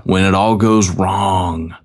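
slow, stretched-out mock-ominous words; dramatic title read. Host repeats the topic title, slow and dramatic. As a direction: draw it out, savouring the title.

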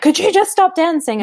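high, loud mock-annoyed mum; playfully imitating a mum telling her dancing child to stop; mock exasperated parent. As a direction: squeal it like a fed-up parent.

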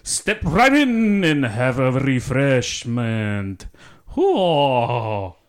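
loud, high, very slow welcome; overblown host. Acted. Actor hosting his friend in an overblown voice. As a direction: ham up a grand host greeting. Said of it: "Maybe a little less than that".

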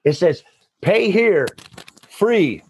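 high, playful hint to click free; playful insistence. Real, not acted. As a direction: nudge them, half joking, toward the free one. Playful hard sell of free button.

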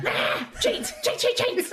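high, loud squawked accusation; shrill outraged heckling; accusing kobold. Acted. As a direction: squawk it like an outraged little creature. Riled kobold accuses opponent in game.

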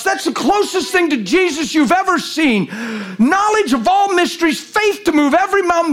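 high, ringing, impassioned sermon; full-force preaching. Real, not acted. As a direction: build to a high, urgent proclamation.

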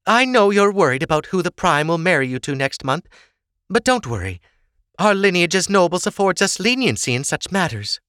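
high-pitched motherly reassurance; reassuring mother. Acted. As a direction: talk your daughter out of worrying, pitched high. Sorsha reassures her daughter.

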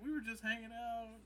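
high-pitched, covering up; fake-innocent excuse. Caught with body, covering for himself.

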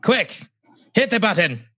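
very high, urgent mid-Atlantic put-on; mock transatlantic accent. Host urging co-host on, high mock transatlantic accent. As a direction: order it like an old transatlantic movie character. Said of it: "that's mid-Atlantic or transatlantic accent".

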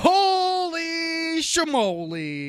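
very high, loud, drawn-out surprised shout; astonished shout. Real, not acted. Reacting to cohost's correct guess, astonished shout. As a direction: stretch it out in mock amazement.

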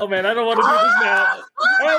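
high, loud panicked cry; dramatic yelp. Real, not acted. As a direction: wail in mock dread. Dreading the mustard.

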